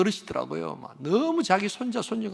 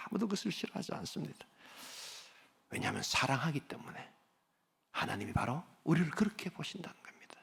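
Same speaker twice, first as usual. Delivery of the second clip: softer, hushed whisper; 12 dB quieter than usual. Real, not acted. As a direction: let it fall to a whisper. Preacher whispers the point. (조용히 속삭이듯)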